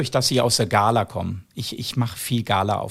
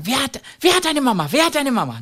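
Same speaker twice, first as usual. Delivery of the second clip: put-on much higher, bright show voice to children; asking the kids; 12 semitones higher, 3 dB louder than usual. Comedian asking the orphan kids on stage, high show voice. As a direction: call out to a room of kids.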